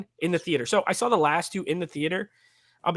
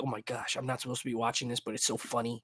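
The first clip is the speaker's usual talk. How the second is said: put-on softer, hushed twelve-year-old thoughts; hushed guilty inner voice; 4 semitones lower, 6 dB quieter than usual. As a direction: keep it low like a kid sneaking a watch. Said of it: "one of those movies where it's like".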